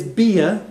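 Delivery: very slow, drawn-out "bia"; teacher modelling Irish; Irish word demo. Shown as a demonstration. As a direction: model "bia" slowly for learners. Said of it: "food is".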